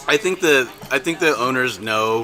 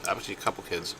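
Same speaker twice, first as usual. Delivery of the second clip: normal talking voice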